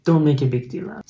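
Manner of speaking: normal talking voice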